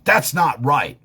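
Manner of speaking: lightly voiced indignant child; scolding child. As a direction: play a kid scolding a grown-up.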